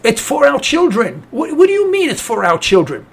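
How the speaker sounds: usual speaking voice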